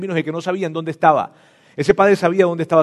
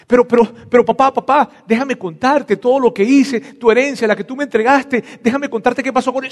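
high, loud, urgent begging; pleading son; 9 semitones higher, 4 dB louder than usual. Acted. Son insisting to his father, high and urgently pleading. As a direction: beg to be heard out.

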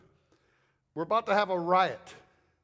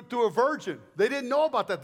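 normal talking voice

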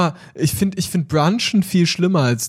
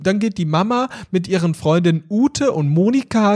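normal talking voice

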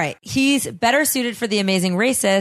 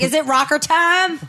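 perky ferret voice; 7 semitones higher than usual. Retold or imitated. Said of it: "She's like".

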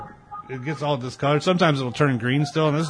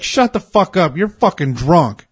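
imitating a fed-up woman arguing back; raised-voice retold telling-off; mock angry retort; 6 semitones higher, 6 dB louder, slower than usual. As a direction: scold the drunk in a raised, higher voice. Retold quarrel, woman snaps back.

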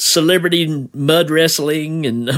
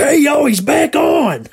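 excited shout; 10 semitones higher, slower than usual. Retold or imitated. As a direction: yell it in delight. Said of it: "It's like".

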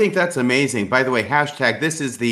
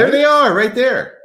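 excited; 11 semitones higher, 5 dB louder than usual. Real, not acted. Pointing at screen excitedly.

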